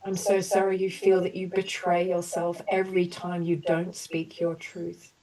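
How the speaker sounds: gentle, measured apology statements for the group; compassionate healing affirmations. Acted. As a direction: offer each sorry like a soothing balm. Leads group through healing apologies.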